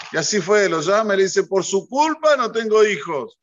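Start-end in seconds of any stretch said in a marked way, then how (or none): 1.4-3.4 higher, louder reproach to the Rosh Yeshiva; accusing complaint. Retold or imitated. Student blaming his teacher on the phone, higher and louder reproach. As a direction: blame him outright, voice raised.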